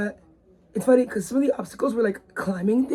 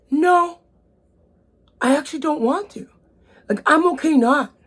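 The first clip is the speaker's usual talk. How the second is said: higher, insistent no; insisting to friends that she won't climb; firm refusal; 6 semitones higher than usual. Retold or imitated. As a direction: refuse it flatly and stand your ground. Retold refusal at rope climb.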